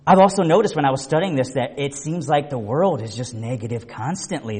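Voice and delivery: ordinary talk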